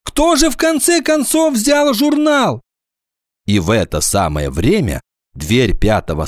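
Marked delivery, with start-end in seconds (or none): 0.1-2.6 high, exasperated wail; distressed teacher at wits' end; plaintive. A narrator's reading. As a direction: plead helplessly, voice pitched high. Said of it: "жалобно сказала Нина Петровна".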